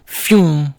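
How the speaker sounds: high, loud "fyoom" sound effect; spoken zoom noise; imitated flying whoosh. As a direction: voice an object zooming past. Hammer flying past.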